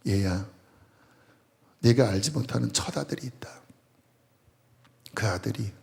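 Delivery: put-on soft, sorrowful old father confiding; a quiet, sorrowful father's confession; hushed father's voice. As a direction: speak low like a grieving father. Father's confession retold in sermon.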